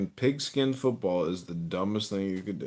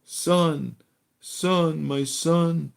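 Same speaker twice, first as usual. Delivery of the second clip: higher, louder pleading father; worried dad; 8 semitones higher, 5 dB louder, much slower than usual. Acted. Comic playing a dad worried about his son, higher pleading voice. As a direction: call out to the son in alarm, higher.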